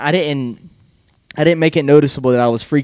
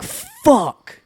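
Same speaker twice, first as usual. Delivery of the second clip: despairing curse; 10 semitones higher than usual. Retold or imitated. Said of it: "I'm like".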